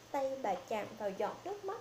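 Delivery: plain reading voice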